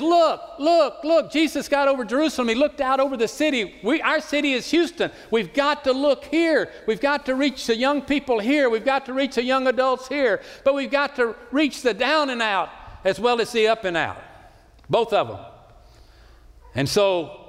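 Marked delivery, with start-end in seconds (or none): 0.0-1.3 high, ringing cry of look look look; Jesus urging his followers to look, raised and ringing; urgent call to look. Retold or imitated. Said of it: "He says". 1.3-15.4 raised, higher pulpit voice driving the point home; fervent pastor rallying his Houston church; full-force preaching. Acted.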